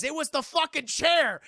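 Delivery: loud, high retold outburst; hammed-up desperate shout; mock frantic accusation. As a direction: yell it, imitating an outraged victim. Victim accusing the chair.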